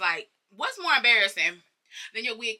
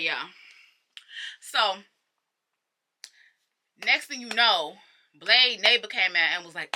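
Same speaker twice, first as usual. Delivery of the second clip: usual speaking voice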